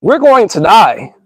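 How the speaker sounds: normal talking voice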